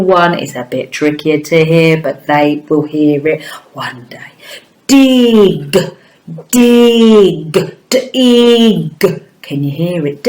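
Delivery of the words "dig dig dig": "Dig" stretched out high, long vowel and released g; "Dig" drawn out high so each sound is heard; emphasised phonemes. Shown as a demonstration. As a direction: hold the i in "Dig", then pop the g. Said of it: "really emphasise those words".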